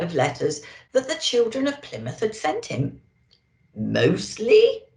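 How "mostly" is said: louder, questioning Santa rise; doubting Santa. A narrator's reading. Santa querying the elf, louder and doubtful. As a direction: Santa sceptical over his glasses. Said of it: "asked Santa".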